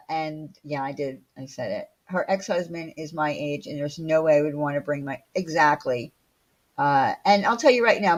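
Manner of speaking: usual speaking voice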